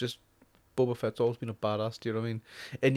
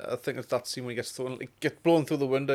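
ordinary delivery

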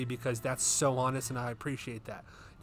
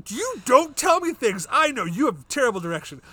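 indignant retort; 7 semitones higher, 7 dB louder than usual. Retold or imitated. Arguing back at wife while driving.

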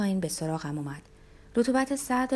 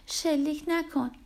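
high, slow tearful plea; drawn-out, pitched-up plea; 8 semitones higher, slower than usual. A narrator's reading. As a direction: beg as if crying. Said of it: "گریان گفت".

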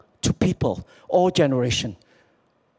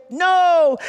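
team shouting back; 11 semitones higher, 4 dB louder than usual. Retold or imitated. Team answers coach's rallying question.

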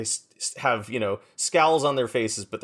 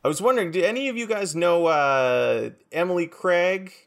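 lightly acted chummy adult, pitched up; awkward adult; 6 semitones higher than usual. Norb approaching a clique of teens in a cafe, awkwardly chummy. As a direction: play the out-of-touch grown-up greeting teens.

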